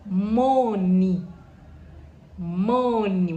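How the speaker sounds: Money said loudly and clearly twice; Chichewa greeting. Shown as a demonstration. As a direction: call out the word for learners. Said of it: "I'll say some words".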